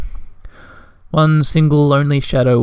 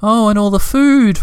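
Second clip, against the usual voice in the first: much higher, wistful sigh of a voice; higher, dreamy little creature; 8 semitones higher than usual. A narrator's reading. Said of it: "said dreamily".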